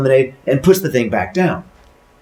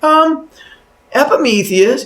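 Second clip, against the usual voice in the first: high, louder put-on wife voice; questioning wife; 5 dB louder, slower than usual. Wife calls husband after tripping, high questioning voice. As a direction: call out high with a puzzled hesitation.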